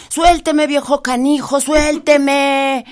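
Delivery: high, loud rabbit shouting to be let go; trapped and frantic, yell at the old man; stuck rabbit yelling. Acted. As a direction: conejo atrapado gritando. Rabbit stuck, demands release.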